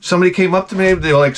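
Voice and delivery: ordinary talk, nothing marked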